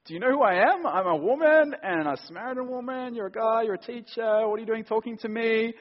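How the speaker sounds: woman's retort. Acted. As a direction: push back at the teacher, half shocked. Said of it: "And she says".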